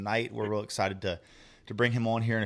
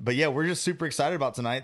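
ordinary talk

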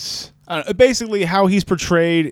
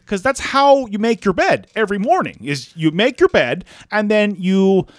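high, fast mock Jordan Peterson voice; Jordan Peterson impression; 5 semitones higher than usual. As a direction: lecture it in a parody Jordan Peterson voice. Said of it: "you sounded like George Bailey".

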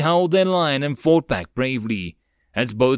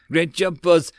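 higher, louder goblin sidekick; lightly voiced goblin praising the boss; eager little henchman's cheer; 5 semitones higher, 4 dB louder than usual. A narrator's reading. Goblin copilot cheering in dream.

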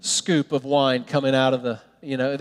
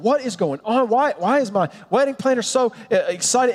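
higher-pitched baffled groom; acted bewilderment; 6 semitones higher than usual. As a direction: play utterly confused by the excitement. Groom baffled by happy planner.

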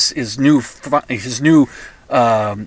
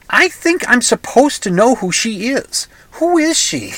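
baffled thought voiced much higher; a lost old guy squinting at the screen; puzzled inner voice; 9 semitones higher than usual. Old guy watching a phone ad, baffled and high. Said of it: "I watch the ad and I think".

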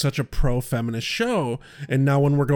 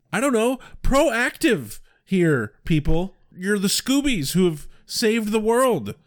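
1 exasperated rant; 7 semitones higher than usual. Real, not acted. Ranting at the characters.

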